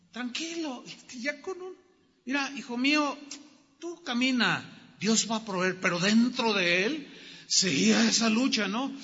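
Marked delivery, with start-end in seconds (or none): 0.1-5.0 softly reassuring a worried son, higher and quieter; calm the boy down gently; a father soothing his son on the walk; tender reassurance. Acted. Abraham calming his son.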